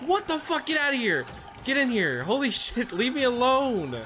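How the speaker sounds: very high, loud, frantic yelling; panicked shout. Real, not acted. Streamer cornered by monsters, shrieking high and loud.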